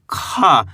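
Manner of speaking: breathy burst on the k of "खा"; long "खा", falling, heavily aspirated; letter खा demo. Shown as a demonstration. Teacher answering own question for learners, letter demo. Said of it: "क्या पढ़ेंगे".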